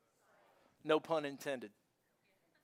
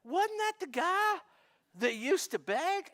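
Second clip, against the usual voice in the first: puzzled double take; 8 semitones higher than usual. Retold or imitated. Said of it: "And you're like".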